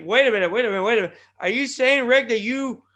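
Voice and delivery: normal talking voice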